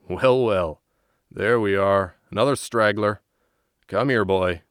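loud, amused gate guard; gruff soldier. Acted. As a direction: call out to the boy, amused. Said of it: "sees him and laughs".

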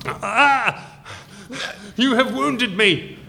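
wounded villain. Acted. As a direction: overplay the hurt villain.

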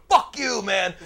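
loud, high yell; a tough guy's furious yell; angry shout. Retold or imitated. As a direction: bark it at the rival table. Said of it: "these monsters are over here going".

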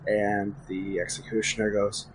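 usual speaking voice

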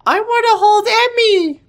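put-on baby voice. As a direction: squeal it like a tiny girl. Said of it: "in her adorable baby voice".

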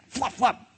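two high, popped sound effects; flying hat sounds. Acted. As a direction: pop out each flap separately. Imitates two hats flying off.

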